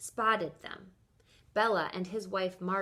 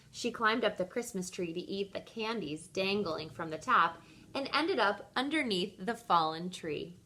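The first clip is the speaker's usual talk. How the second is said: plain reading voice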